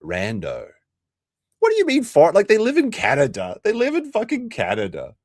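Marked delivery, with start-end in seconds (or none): 1.6-5.1 mock outrage. Mock outraged reply to chat.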